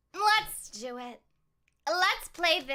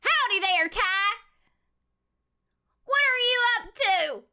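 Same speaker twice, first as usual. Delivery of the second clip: put-on country accent; 7 semitones higher, 4 dB louder than usual. Streamer greets a viewer in a hammed-up country accent. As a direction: greet him like a friendly cowboy. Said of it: "I will speak in a country accent for you".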